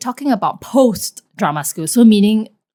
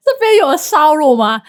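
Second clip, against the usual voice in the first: imitating auntie asking; 7 semitones higher, 4 dB louder than usual. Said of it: "They asked".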